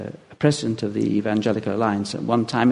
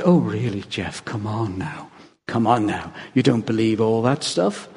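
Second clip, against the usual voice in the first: mild mock scorn; 3 semitones lower than usual. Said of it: "she sniffed".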